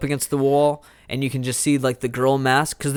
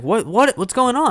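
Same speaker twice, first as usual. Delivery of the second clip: scared; 10 semitones higher, 3 dB louder than usual. Retold or imitated. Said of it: "she's just, like, scared".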